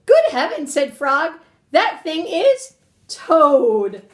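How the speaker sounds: animated, emphatic storybook reading; story read-aloud to children. Acted. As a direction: punch key words, lingering on descriptive ones.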